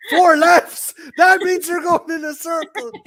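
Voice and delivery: loud, high, disbelieving outburst; incredulous shouting at the driver; exasperated shout. Retold or imitated. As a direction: yell it like you can't believe the driver. Exasperated passenger argues about directions.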